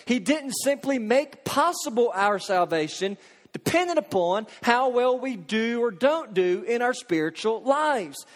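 ordinary talk

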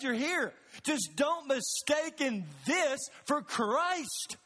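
urgent pulpit preaching, slowing on the key lines; preaching at full force. Real, not acted. Preaching climax to congregation.

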